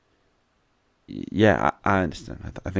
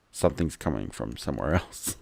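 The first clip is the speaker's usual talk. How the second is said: normal talking voice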